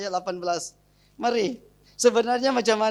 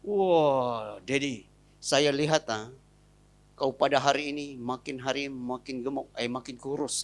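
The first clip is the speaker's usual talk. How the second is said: wife flattering husband; 7 semitones lower, 4 dB quieter than usual. Retold or imitated. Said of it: "keluar saja".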